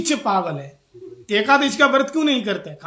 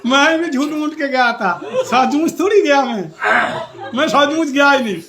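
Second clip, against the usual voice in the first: loud, higher comic retort; gleeful punchline; 4 semitones higher, 7 dB louder than usual. Retold or imitated. Returning guest reveals his own pretence to the couple, loud and gleeful. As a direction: crow it as the joke's big reveal.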